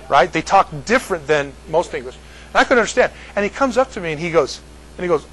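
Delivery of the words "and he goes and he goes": ordinary talk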